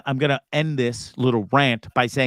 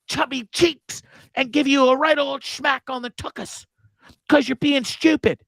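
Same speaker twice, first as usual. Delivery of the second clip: British mum scolding; 8 semitones higher than usual. Retold or imitated. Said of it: "as me mum would say".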